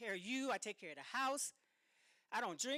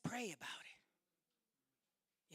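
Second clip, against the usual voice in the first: hushed, slow whisper; a slow hushed whisper of advice; 5 dB quieter, much slower than usual. Retold or imitated. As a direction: breathe the words softly.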